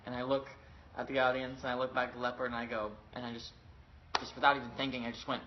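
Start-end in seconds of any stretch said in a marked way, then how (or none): none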